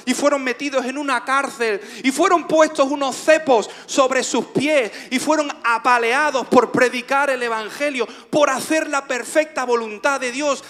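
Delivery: loud, high sermon delivery; preaching. Real, not acted. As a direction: proclaim it to the congregation, drawing out key words. Preaches at full force. (predicación enérgica, voz alta y aguda)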